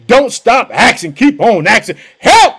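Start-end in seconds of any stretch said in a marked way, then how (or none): 2.2-2.5 sudden high loud cry of help; shouted cry for help. Shown as a demonstration. Preacher showing the congregation how to shout help. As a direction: cry it out like a desperate beggar. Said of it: "That's what you gotta say".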